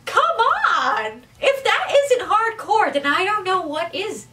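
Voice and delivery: high-pitched hype, rising loud on the come on; excited. Real, not acted. Fan gushing to viewers about a hardcore anime fighter, excited. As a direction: gush like a fan who can't believe how cool it is. Hyped about a metal character.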